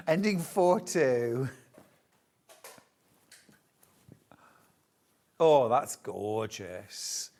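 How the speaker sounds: a slow, hammed-up vaguely Mediterranean accent; half-hearted Italian-sounding voice; mock Mediterranean accent. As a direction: keep the bad Mediterranean accent going, slowly.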